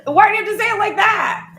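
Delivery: hammed-up hurt whine mid-argument; whiny complaint. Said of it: "then you'll get in a fight".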